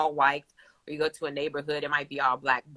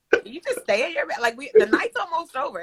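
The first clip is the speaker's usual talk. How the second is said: exasperated plea; 10 semitones higher, 4 dB louder than usual. Retold or imitated. Mom retells asking son to stay.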